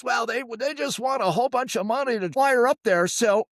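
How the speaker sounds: high, creaky old man imitation; creepy old man voice. Son imitates his dad for his sister, creepy old man voice. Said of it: "when did Dad get creepy old man's voice".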